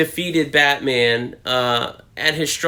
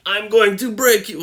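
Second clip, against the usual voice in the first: put-on higher Russian-accented villain voice; Russian-accented Bane; 8 semitones higher than usual. Comic fan quoting Bane to viewers, Russian accent. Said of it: "I did a Russian voice for Bane".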